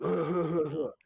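loud exaggerated fake cough. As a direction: imitate someone coughing on purpose. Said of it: "starts, like, fake coughing".

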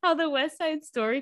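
loud, laughing restart. Real, not acted. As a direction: giggle through the words. Laughing fit.

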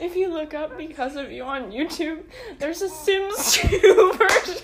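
breaking into laughter mid-sentence, pitch climbing; real laughing fit, words stretched out; laughing hard. Real, not acted. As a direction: try to finish the line while cracking up. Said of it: "I'm laughing so hard".

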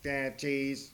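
imitating a computer accent on "Stachys"; mock computer-accent pronunciation. Preacher copying his computer's pronunciation, computer accent. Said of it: "He has that computer accent".

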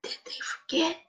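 a whispered rhetorical question; hushed whisper. Acted. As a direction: drop to a breathy whisper.

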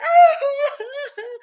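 berrido agudo y fuerte, imitado; llanto a gritos. Retold or imitated. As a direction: llorar a todo pulmón, exagerando. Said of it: "y ahora". (alarido lloroso fingido)

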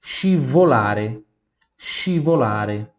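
scivolare said slowly, shi-vo-LA-re; slow Italian teacher model. Shown as a demonstration. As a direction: drag scivolare out for repeating. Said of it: "R E".

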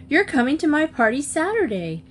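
high-pitched, insistent reproach; accusing. A narrator's reading. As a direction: hold her to it accusingly. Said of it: "Marcia cried accusingly".

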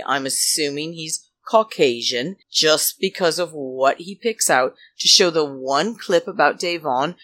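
ordinary talk

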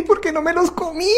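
very high, mock-exasperated punchline; exasperated parent joke. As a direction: deliver it as a comic sigh of regret. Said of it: "uno dice". (queja cómica de padre, muy aguda)

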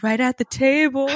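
imitating the bartender's echo; mock bartender echo. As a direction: echo the words like a backup singer. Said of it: "the bartender's also like".

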